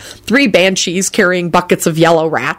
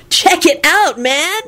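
high-pitched, wowed dude; excited; 10 semitones higher, slower than usual. A narrator's reading. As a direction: show it off excitedly. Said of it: "He pointed excitedly".

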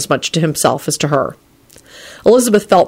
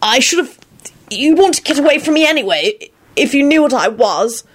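high, halting anguish; 9 semitones higher than usual. A narrator's reading. Lupin sure Elizabeth would flee him, anguished. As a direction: break off, then press on in anguish. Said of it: "in an anguished voice".

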